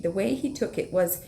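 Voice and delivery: normal talking voice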